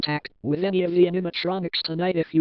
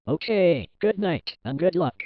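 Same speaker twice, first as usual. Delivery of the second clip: ordinary talk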